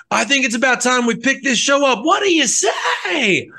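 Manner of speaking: high stage call, stretched at the end. Acted. Frontman picking the show up, hammed-up stage shout. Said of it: "he would always have that same inflection".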